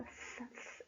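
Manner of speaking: unvoiced "z-" attempts at the lisped th sound; failed Castilian z. Shown as a demonstration. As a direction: try and fail the th sound of "z-". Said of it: "eu não sei fazer esse som". (tentativa sussurrada do som de z castelhano)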